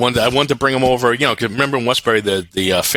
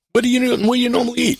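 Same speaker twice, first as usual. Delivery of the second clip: 1 quizzing fellow Brooklynite; 14 semitones higher, faster than usual. Retold or imitated. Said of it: "It's like".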